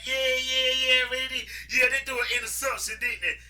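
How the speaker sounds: high teasing singsong; mock them like jeering friends; high, taunting chant.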